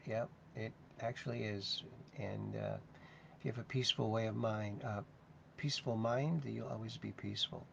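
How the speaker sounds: ordinary talk, nothing marked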